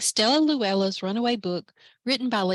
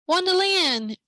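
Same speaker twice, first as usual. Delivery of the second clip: higher, sharp call of a policeman; barked; 8 semitones higher, 3 dB louder, slower than usual. Acted. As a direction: bark her name like an order. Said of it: "barked Officer Tim".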